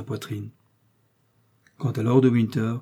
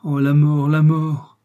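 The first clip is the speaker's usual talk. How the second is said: louder, higher cry of despair; theatrical lament, voice raised; anguished exclamation; 5 semitones higher, 8 dB louder, slower than usual. A narrator's reading. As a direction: cry out for death. Milady begs for death.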